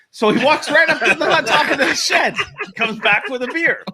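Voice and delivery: talking through laughter, pitched high; high, laughing storytelling voice. Real, not acted. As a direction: squeeze the story out while laughing. Amused telling of absurd hook story.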